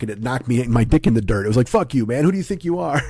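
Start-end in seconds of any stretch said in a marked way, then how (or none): 1.7-3.0 higher, lightly outraged telling-off; who-do-you-think-you-are challenge, pitched up; mock indignant. His body tells off the podcaster, mock indignant.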